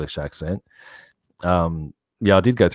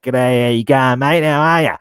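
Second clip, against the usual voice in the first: loud, slurred Aussie greeting, higher-pitched; broad northern Australian accent; 5 semitones higher, 6 dB louder than usual. Retold or imitated. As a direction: run it together in thick outback Australian. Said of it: "very laid back".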